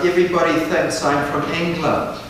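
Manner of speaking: ordinary talk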